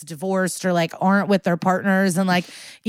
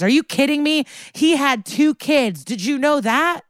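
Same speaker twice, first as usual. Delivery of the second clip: mock angry outburst; 8 semitones higher than usual. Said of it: "he goes".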